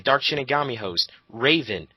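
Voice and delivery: ordinary talk, nothing marked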